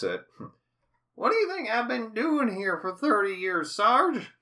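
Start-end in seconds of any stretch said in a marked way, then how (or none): 1.1-4.3 put-on high old man voice; wry old timer's question. Voicing Bob.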